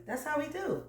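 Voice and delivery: ordinary talk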